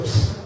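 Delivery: short hissed "psst"; quick psst through the teeth; imitated parrot psst. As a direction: mimic an attention-getting psst. Customer imitates the parrot's signal.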